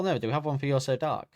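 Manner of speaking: ordinary delivery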